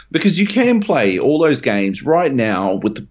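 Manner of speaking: normal talking voice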